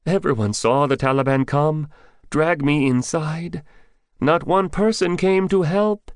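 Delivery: put-on high, loud, shaken young man's voice; a frightened villager pouring out his hurt; trembling with fear. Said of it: "Bidar trembled".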